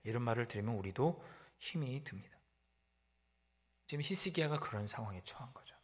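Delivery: ordinary talk, nothing marked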